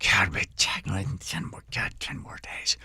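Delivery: whispered, slow cursing to herself; cursing under breath. Retold or imitated. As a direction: curse softly under your breath, barely voiced. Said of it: "quietly cursing under her breath".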